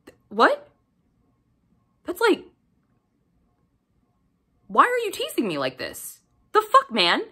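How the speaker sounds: rising incredulous whine; mock outraged disbelief. Reader complaining to the author, rising disbelief. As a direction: sound betrayed by the book. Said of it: "And you're like".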